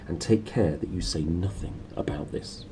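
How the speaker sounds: hushed whisper; whispered warning. Acted. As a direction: keep it low so the servants won't hear. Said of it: "Quiet, said her uncle".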